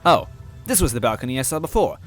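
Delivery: ordinary delivery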